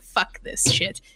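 under-the-breath whispered curse; muttered curse. Retold or imitated. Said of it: "'cause I was like".

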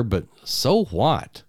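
ordinary delivery, nothing marked

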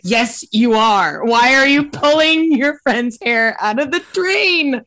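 loud mock scolding, rising high; exasperated outburst. Acted exasperated reply to man.

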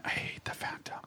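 hushed aside whisper. Real, not acted. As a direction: whisper it under your breath. Muttered aside about disliked show.

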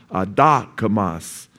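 Adokimos drawn out slowly, ah-DOH-kee-mos; slow teaching take of Adokimos; slow Greek syllables. Shown as a demonstration. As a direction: lay out each sound of Adokimos for the class. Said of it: "It's an ah with a O with a ah sound".

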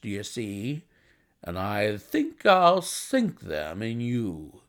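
hearty gentleman with drawn-out words; bustling visitor. Acted. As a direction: make a bluff, confident proposition. Narrator voices the visitor Blessington.

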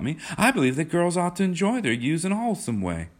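put-on high-pitched, insistent girlish protest; persisting. A narrator's reading. Kismine insists to John she is innocent, high girlish pleading. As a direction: gush out the protest, high and emphatic. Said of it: "she persisted".

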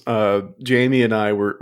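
ordinary talk, nothing marked

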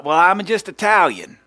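loud, high mock self-justification; mock excuse. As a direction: blurt it out as a put-on excuse. Mimics an Italian excusing meanness.

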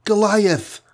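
high-pitched cry of the tiny heroine; screaming. A narrator's reading. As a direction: scream a name high up, without much volume. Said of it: "screamed the tiny creature".

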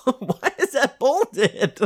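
giggling question; laughing. Real, not acted. Laughing at bolded text.

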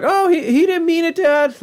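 high, stammering boy impression; Morty. Son defends the dog to Dad, high and pleading. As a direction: plead in a squeaky put-on voice.

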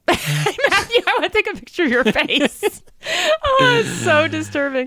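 high, breaking with laughter; can't stop laughing at his face; laughing hysterically. Real, not acted.

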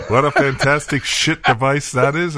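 laughing. Retold or imitated. Teasing repeat of the slip.

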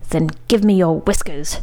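plain reading voice

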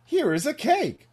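high-pitched, loud little critter; squeaky cartoon animal offering; Mister Rabbit. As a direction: pipe up high and eager. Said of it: "uh, Mister Rabbit".

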